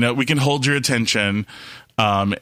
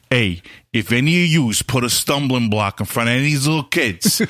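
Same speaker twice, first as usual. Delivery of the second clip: put-on New York mobster accent; Sopranos mafia. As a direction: menace like a TV mobster. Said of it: "like, a Sopranos mafia moment".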